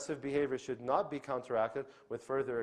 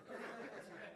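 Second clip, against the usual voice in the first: quiet laugh; 13 dB quieter than usual. Real, not acted. Laughs at own parody.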